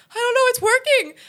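high, loud, teary wail; a choked-up squeal of relief; tearful crying. Retold or imitated. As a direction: blurt it out through happy tears. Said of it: "crying, like, sobbing".

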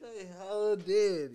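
slow, high, laughing it out. Real, not acted. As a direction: say it while cracking up. Laughing through the retort.

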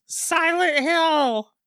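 high, drawn-out mock scream; imitating a child's scream, slow and high. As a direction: stretch it out slowly in a high imitated cry. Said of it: "She screams".

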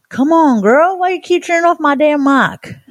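high-pitched mock complaint; squeaky, teasing outrage; playful exasperation. Real, not acted. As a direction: whine it up high at your co-host in joking protest. Protests to co-host about mic.

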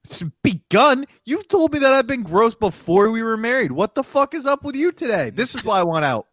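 hammed-up offended wife; play-acting an outraged spouse; mock outrage. Mock marital quarrel reply.

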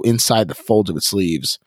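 ordinary talk

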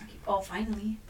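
whispered aside; whispering. Real, not acted. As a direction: breathe it as a secret. Aside between hosts.

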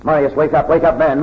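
high, loud frantic shout; a soldier shaking his comrade awake, shouting frantically. Acted. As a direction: yell him out of sleep. Shaking his sleeping friend awake.

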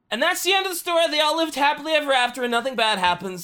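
bright, fast fake happy ending; mock cheerful. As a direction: rush a sarcastic storybook ending.

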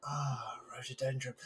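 soft, awed sigh of recognition; hushed awe. Retold or imitated. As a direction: breathe it out in wonder. Awed reaction to the same word.

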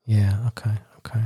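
soft whispered okays; whispering. Real, not acted. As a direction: breathe the words, barely voiced.

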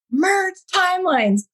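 high-pitched mock scream, slow and insistent; imitating him yelling an order; retold screaming. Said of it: "he starts screaming at me".

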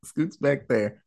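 ordinary delivery

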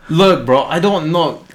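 usual speaking voice

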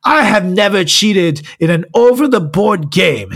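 hammed-up British accent, rising insistence; mock British accent. Host mimics the accused chess player's denial, mock British accent. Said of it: "I just gave him an accent".

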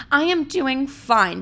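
high, slow put-on cheer; mock positivity. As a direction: imitate forced positivity, each word drawn out. Mimics someone masking feelings.

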